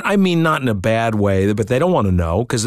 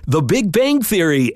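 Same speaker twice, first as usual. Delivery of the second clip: announcer voice; 8 semitones higher, slower than usual. Retold or imitated. Announcer read in hotel room.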